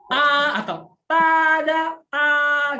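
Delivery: A and TADA held high and level; loud, drawn-out A and TADA on one high pitch; first tone A. Shown as a demonstration. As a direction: sustain A high and even, like a surprise TADA. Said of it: "nada satu tadi".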